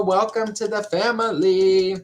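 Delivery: ordinary talk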